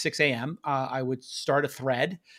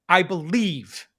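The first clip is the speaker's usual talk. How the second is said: ordinary talk, nothing marked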